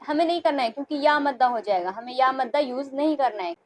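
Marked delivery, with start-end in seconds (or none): none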